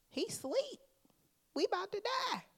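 high comic panicking voice; mock panicked disciples. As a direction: play the scared disciples for laughs.

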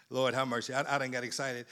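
low, subdued self-check; quiet aside. Real, not acted. Preacher admitting he got excited, quiet low aside. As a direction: drop right down, as if to yourself.

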